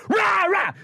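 high shouted mock roar; mock wolf roar. (høyt spøkefullt ulvebrøl)

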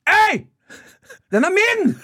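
very high-pitched yell across the car park; mock-furious possessive shout; imitated screaming. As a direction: scream it like an outraged mother. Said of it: "driver mamma nå og skriker".

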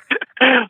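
bright burst of laughter; high laugh. Real, not acted. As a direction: laugh at your own cheek. Laughing at his own story.

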